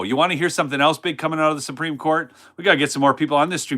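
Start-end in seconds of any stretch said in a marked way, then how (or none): 0.0-2.3 fast, pushing repeat of the question; quick re-ask. Shown as a demonstration. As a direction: hurry the chat to answer. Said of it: "You gotta say yes or no".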